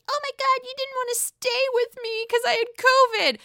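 high whiny mock voice; imitating Shep's girlfriend. Shep's girlfriend complaining to Shep, high whiny voice. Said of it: "pissed off at Shep saying".

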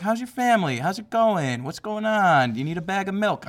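sing-song nosy neighbour; Midwest small talk voice. Retold or imitated. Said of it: "they're like".

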